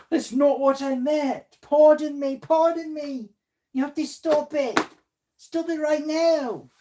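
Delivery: very high, slow mock-indignant voice; hammed-up high scolding; put-on accent voice. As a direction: protest in a silly, high-pitched character voice. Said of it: "my very different accents I do".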